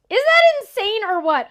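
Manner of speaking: high, loud, thrilled exclamation; excited squeal. Real, not acted. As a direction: gush at the big reveal. Excited reveal of card.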